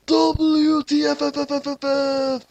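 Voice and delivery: high, slow, drawn-out letters; high, slow mock outrage; mock outraged Kira. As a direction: spell it out in stunned disbelief. Kira spells out shock.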